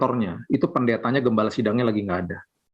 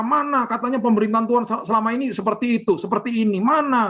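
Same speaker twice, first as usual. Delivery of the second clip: high, raised indignant yelling of Mana; mock furious caller demanding where God is; angry demand; 9 semitones higher than usual. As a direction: throw the accusation back, much higher. Staff voicing angry callers.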